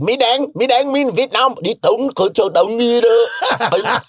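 high, guttural mock Vietnamese; Vietnamese impression. Comedian imitating Vietnamese neighbors he grew up with, high and guttural. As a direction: mimic Vietnamese speech up high and throaty. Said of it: "that's Vietnamese".

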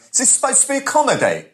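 high-pitched, indignant mock complaint. Imitates loud man heckling cinema.

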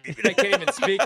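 Beaker voice. Retold or imitated. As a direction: choke the words out high and loud. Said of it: "Sound like Beaker".

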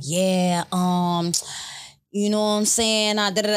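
put-on New York accent. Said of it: "you know the New York accent".

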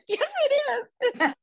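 very high, slow, wobbling half-cry; mock choked-up wail. Reporter answering a teary retiring star, in a hammed-up choked voice. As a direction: squeak it out as if about to burst into tears. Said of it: "I'm like".

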